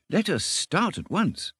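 high, slow, savoured eagerness; eager delight. A narrator's reading. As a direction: urge it with dreamy delight. Blissful mole eager to go.

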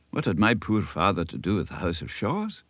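usual speaking voice

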